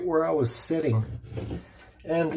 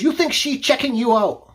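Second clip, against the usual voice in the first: high-pitched yelled accusation; retold angry yell; 8 semitones higher, 4 dB louder than usual. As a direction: mimic his broken English, pitched up. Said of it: "he looks at me and he yells".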